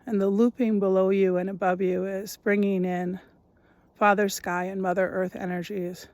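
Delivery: measured meditation voice, long pauses between phrases; guided meditation. Acted. Guide listeners into noticing their breath, guided meditation voice. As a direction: lead listeners gently through each step, leaving space.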